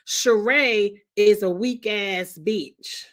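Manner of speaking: slow, spaced-out cursing; slow emphatic dig; slow deliberate insult. Real, not acted. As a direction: lay it down word by word. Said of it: "I said I wasn't gonna curse".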